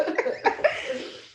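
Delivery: breathy laugh. Real, not acted. Moved laughter.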